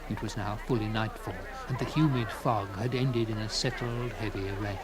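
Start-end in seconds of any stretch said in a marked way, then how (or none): none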